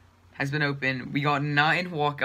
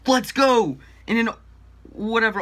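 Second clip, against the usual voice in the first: loud, high cheer; excited shout; 7 semitones higher, 7 dB louder than usual. Real, not acted. Walkout celebration.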